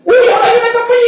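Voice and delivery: high, fast, half-crying mock excitement; hammed-up eager squeal; imitating excited mother. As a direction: mimic mom rushing off to hear a preacher. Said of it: "mi mamá era de aquellas que decía".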